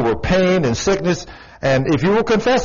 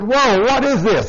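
high, slow, drawn-out "whoa" of amazement; mock astonishment; 7 semitones higher than usual. Preacher telling the church about the pain, staging his own astonished reaction. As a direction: act baffled by the pain moving.

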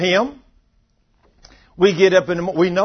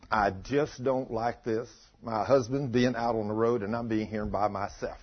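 low, soft complaint to God; subdued, weary-sounding complaining; retold grumbling; 8 semitones lower, 9 dB quieter than usual. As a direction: imitate a lonely wife grumbling under her breath. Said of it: "she's grumbling and complaining".

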